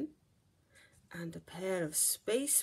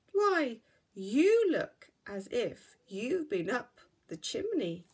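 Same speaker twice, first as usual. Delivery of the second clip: mildly scolding, louder mum; a hint of a scolding mother; 3 semitones higher, 7 dB louder than usual. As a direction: tut at the sooty cub. Mother scolds sooty cub.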